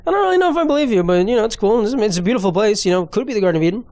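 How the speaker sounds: high, sing-song fake politeness; mocking high polite tone; mock polite doubt. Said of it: "And I'm like".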